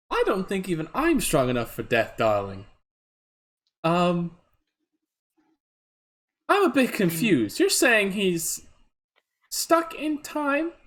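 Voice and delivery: high, slow, grand lady; theatrical diva voice. Acted. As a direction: sweep in like a glamorous diva.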